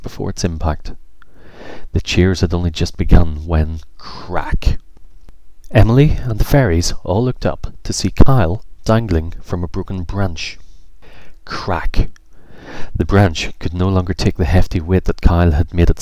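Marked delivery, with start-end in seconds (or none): 4.0-4.8 whispered snap of a branch; sharp crack sound. A narrator's reading. 11.4-12.1 whispered snap of a branch; sharp crack sound. A narrator's reading. Branch breaking again.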